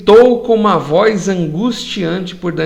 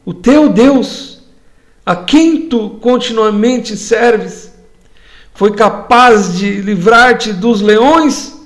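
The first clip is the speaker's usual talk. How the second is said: anguished king calling out, higher, louder and slow; voz angustiante; 3 semitones higher, 4 dB louder, slower than usual. Acted. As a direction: cry out to Daniel in anguish, slowly drawing out each phrase. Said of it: "eu vou interpretar, com a voz angustiante". (o rei clamando com voz angustiante, alta e lenta)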